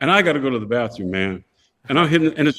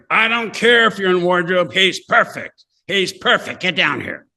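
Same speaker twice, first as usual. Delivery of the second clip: raised voice heard from another room; mock holler, higher than usual; imitated yelling; 3 semitones higher than usual. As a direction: imitate an insistent call through the wall, pitched up.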